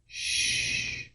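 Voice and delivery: soft whispered shush; whispered shh to calm them; hushing. Acted. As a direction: hush everyone gently. Doctor hushing the room.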